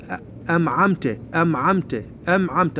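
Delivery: أمعمت with m replacing n; imitating a misreading, أمعمت with m; mistaken m. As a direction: repeat أمعمت as "am'amta". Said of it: "بشكل سريع يقرأ".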